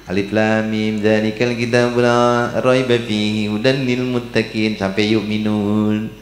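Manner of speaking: measured monotone recitation, held syllables; Quran recitation. Acted. Preacher shows congregation a short daily Quran reading, reciting. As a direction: recite the verse slowly and evenly.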